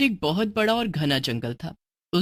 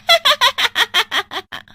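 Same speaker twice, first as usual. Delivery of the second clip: evil cackle; 7 dB louder than usual. Acted. Witch cackle.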